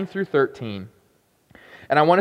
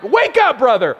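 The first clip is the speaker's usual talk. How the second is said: much higher and louder, jolting; mock wake-up call; 7 semitones higher, 6 dB louder than usual. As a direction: shout it to shake someone awake. People rousing the yawning groom.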